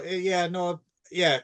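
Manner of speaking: ordinary talk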